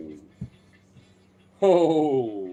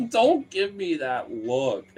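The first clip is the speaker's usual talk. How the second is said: usual speaking voice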